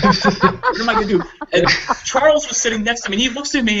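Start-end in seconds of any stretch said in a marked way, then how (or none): 0.0-1.2 high, squeaky laughing panic; a high laughing wail of helplessness; hammed-up panic, laughing; mock panic. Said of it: "emotionless face".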